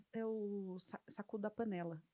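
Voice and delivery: ordinary talk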